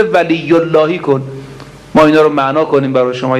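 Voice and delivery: ordinary talk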